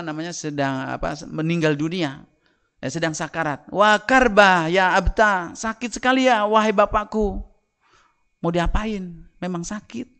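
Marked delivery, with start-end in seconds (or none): 3.7-7.4 high, loud, slow wail on karba; lamenting verse. Acted. Fatimah laments her dying father, high slow loud wail. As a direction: cry out a father's pain. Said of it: "Fatimah mengucapkan syair".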